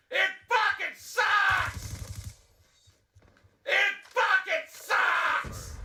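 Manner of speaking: yelling at the game in frustration; furious shouting rant; raging gamer. Real, not acted. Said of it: "rage gamer".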